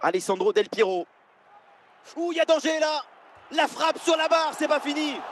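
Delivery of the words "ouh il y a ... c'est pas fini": high urgent play-by-play; rising alarm near the goal; excited sports commentary. Acted. Chance hits the crossbar. (commentaire haletant et aigu)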